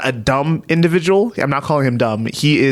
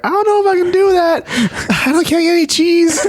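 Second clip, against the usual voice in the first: squeaky high worried voice; high whiny disciple. Retold or imitated. Disciple in the back reacting to Jesus, high and panicked. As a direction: pitch it up like a nervous nobody.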